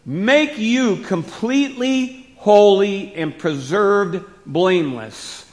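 forceful preaching cadence; strong emphatic words. Acted. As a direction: punch out each key word slowly. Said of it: "those are strong words".

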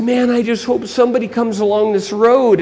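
long for help while hurting; acted plaintive victim; injured man wishing.

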